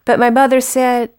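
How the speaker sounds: measured storytelling recital; poem reading. Acted. Poet recalls mother correcting her speech, measured recital. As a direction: read the verse with pauses between lines. Reads Southern Accent poem.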